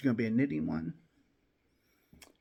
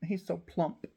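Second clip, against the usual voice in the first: cooing over kitten; 8 semitones higher than usual. Real, not acted. Owner coos high over her plump kitten. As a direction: gush over the little kitten in a high sing-song.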